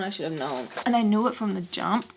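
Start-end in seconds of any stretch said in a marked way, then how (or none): 0.0-0.7 trailing off softly; quiet. Real, not acted. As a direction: let it fade to a sigh. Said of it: "repeated with fading".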